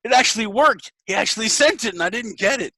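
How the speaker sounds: thrilled it worked; excited scammer. Retold or imitated. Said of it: "And then they're like".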